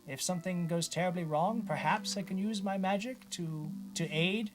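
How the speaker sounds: high-pitched, hesitant character voice; Bartleby. Acted. Wizard offers to take prisoner's place, high put-on voice. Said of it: "Bartleby actually speaks up. He says".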